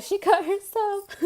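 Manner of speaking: mock alarmed report. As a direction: announce it in a high panicky voice. Coworker reports injury in alarm.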